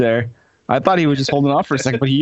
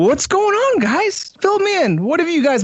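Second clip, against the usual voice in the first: high voice; 14 semitones higher than usual. Acted. Mock greeting.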